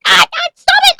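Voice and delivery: yelling stop. Acted. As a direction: yell over the chaos to stop the kids. Breaking up kids' wrestling.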